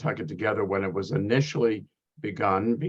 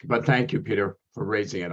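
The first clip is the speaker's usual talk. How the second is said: ordinary talk, nothing marked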